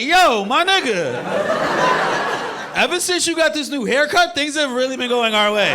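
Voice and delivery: high, loud, hyped-up praise; excited shout. Acted. Kid to his generous cousin after Disneyland trips, excited shout. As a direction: gush loudly at a high pitch.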